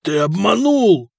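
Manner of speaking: медленно, обречённо, но в голос; протяжный возглас обречённого; обречённость. A narrator's reading. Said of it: "обречённо прошептал Фока". (с горечью обречённого, растягивая слова)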